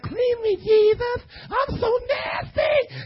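high, crying mock plea; hammed-up sinner begging to be cleaned; whiny sinner. Preacher mocking a sinner pleading with Jesus, high whiny cry.

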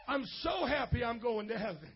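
ordinary delivery

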